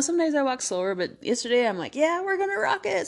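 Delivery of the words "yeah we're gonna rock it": high, hyped voice, cheering herself on; pumped-up self-talk. Retold or imitated. Psyching herself up on the treadmill, high excited cheer. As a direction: talk yourself into going faster, way up high.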